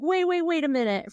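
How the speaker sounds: high-pitched objection, mildly hammed up; imitated interruption. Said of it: "the guy's like".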